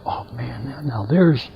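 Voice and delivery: breathy awed whisper to himself. Real, not acted. Watching the woman lecture, he whispers in awe. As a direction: whisper it, impressed, not to interrupt.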